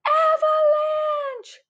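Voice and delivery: very high, loud, drawn-out yell; panicked full-voice warning cry; alarm shout. A narrator's reading. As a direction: scream a long warning. Granddude warns of sliding snow.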